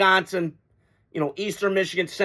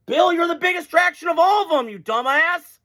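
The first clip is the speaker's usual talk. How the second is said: higher, raised voice scolding Bill; exasperated call-out; 7 semitones higher than usual. Real, not acted. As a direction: yell at him in disbelief. Mock-scolding Belichick directly.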